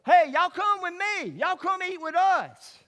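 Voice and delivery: put-on high, loud, slow call of a little boy; child calling out. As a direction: call them over like an eager kid. Little boy calling them over.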